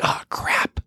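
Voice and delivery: hushed, breathy oh crap under the breath; whispering in dismay at the full car; whispered curse. Retold or imitated. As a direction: mutter it so only you can hear. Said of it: "I'm like".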